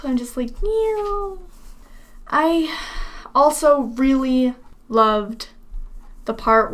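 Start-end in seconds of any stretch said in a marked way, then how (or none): 0.6-1.4 soft, high little meow; melting meow. Acted. Fan melting at hearing Zelda, soft high meow. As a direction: melt into a high-pitched mew. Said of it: "I just kinda melt".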